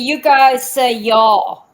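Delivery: y'all drawn out slowly, stressed; slow contrast. Shown as a demonstration. Comparing regional speech with a Kentucky friend, slow. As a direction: lean slowly on guys and y'all. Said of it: "We say yous guys".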